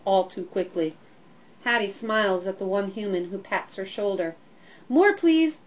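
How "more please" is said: dog barking a plea. A narrator's reading. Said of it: "I bark".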